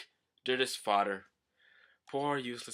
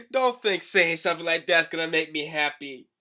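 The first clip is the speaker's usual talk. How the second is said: flattered but protesting, high and loud; giggly, high cartoon denial; bashful Chopper; 5 semitones higher, 7 dB louder than usual. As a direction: protest a compliment while secretly delighted. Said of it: "he's blushing and everything".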